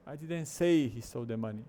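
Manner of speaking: "say" long and punched; stress on SAY. Shown as a demonstration. Teacher showing thought groups, stress on "say". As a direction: land hard on "say". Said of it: "nesse segundo formato".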